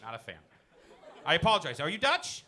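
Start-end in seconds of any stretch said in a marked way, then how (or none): none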